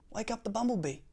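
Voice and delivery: high, perky squirrel voice; storybook squirrel. A narrator's reading. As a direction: pipe up with urgent squirrel advice. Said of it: "said the squirrel".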